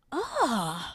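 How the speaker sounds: high exasperated groan at herself; annoyed ugh. Real, not acted. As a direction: let out a frustrated ugh. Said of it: "I hate this for myself".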